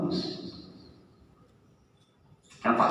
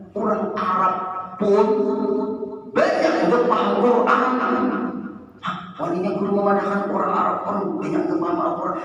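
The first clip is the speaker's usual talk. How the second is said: loud, high-pitched sermon voice, hammering each point; preaching at full force; 9 semitones higher, 5 dB louder than usual. Acted. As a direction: preach it hard to the crowd. Preacher raises voice to congregation. (berkhotbah dengan suara lantang dan tinggi)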